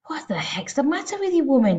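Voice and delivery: indignant father's shout, high at first. A narrator's reading. Father scolding his wife over the dropped plate, shouted. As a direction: shout it as an outraged husband. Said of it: "he shouted".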